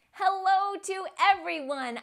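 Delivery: ordinary delivery, nothing marked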